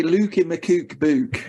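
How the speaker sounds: thick Macclesfield vowels, drawn out slowly; Macclesfield accent. Shown as a demonstration. Demonstrating how locals say it, in a proper Macclesfield accent. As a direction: stretch it out in broad Macclesfield accent. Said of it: "You've got a proper Mac accent".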